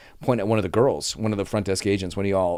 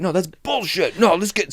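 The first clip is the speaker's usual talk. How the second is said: much higher-pitched indignant protest; mock angry customer; 9 semitones higher, slower than usual. Angry guest objecting in the line, mock indignation. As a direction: imitate a guest kicking up a fuss.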